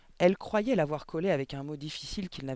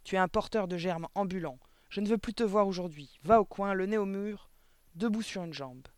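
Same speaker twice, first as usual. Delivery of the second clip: loud, higher barrage of orders; punishing headmistress; 5 semitones higher, 5 dB louder than usual. A narrator's reading. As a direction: banish the boy to the corner. (directrice furieuse qui punit, voix forte)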